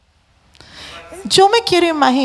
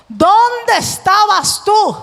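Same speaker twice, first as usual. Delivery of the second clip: voice of God; 6 semitones higher, much slower than usual. Acted. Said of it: "Y Dios le dijo".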